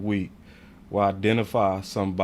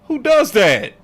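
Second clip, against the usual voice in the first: louder and much higher, heated; real anger; 9 semitones higher, 10 dB louder than usual. Real, not acted. Host ranting at fans about leadership, loud and angry. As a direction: rant it, fed up. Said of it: "I'm sorry to get angry".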